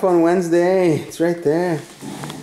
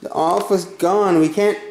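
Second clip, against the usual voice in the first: usual speaking voice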